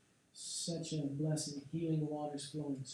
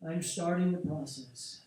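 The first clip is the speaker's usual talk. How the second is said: usual speaking voice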